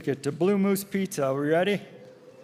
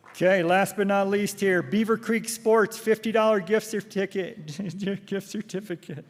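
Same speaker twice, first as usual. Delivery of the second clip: raffle caller; 4 semitones higher, slower than usual. Acted. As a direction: call the numbers slowly to the crowd. Calling the last raffle prize.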